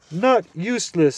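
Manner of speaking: high, loud owlish hoot of a voice. Acted. Owl hooting up at the deaf dwarf.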